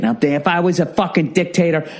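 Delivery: normal talking voice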